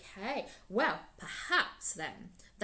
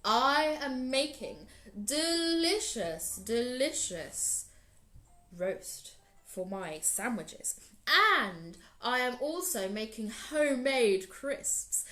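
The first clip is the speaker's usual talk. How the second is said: big proud lion; 2 semitones higher, 5 dB louder than usual. Acted. Lion boasts to Anansi about his lunch, loud and grand. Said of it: "said Lion".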